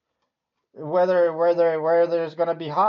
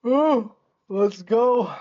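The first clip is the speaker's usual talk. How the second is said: excited; 7 semitones higher, 6 dB louder, much slower than usual. Real, not acted. Market starts moving up.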